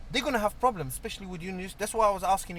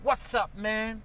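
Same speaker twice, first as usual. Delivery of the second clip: casual American "What's up, man?"; drawled like a US teen; American slang; 5 semitones higher, much slower than usual. Retold or imitated.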